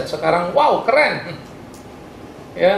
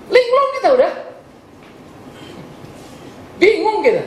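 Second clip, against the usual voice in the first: laughing despair. Real, not acted. Laughing at the messy policing.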